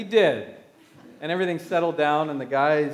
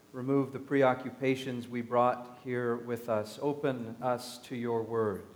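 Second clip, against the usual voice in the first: softer, reverent prayer voice; 5 semitones lower, 7 dB quieter than usual. Acted. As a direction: lead the congregation quietly into prayer. Leads an opening prayer.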